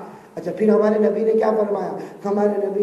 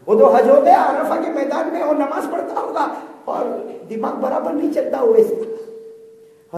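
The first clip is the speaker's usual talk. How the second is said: dismissive uncle; 7 semitones higher, 4 dB louder than usual. Acted. Uncle voiced in the story.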